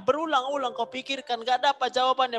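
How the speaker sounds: high, loud, relentless repetition; frantic overthinking. Shown as a demonstration. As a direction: hammer it round and round like racing thoughts. Dramatizing endless, fruitless overthinking. (berulang-ulang dengan nada tinggi dan keras)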